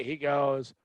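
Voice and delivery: ordinary delivery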